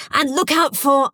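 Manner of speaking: urgent, insistent order; urgent hiss. A narrator's reading. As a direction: urge a soldier on at a critical moment. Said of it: "she hissed urgently".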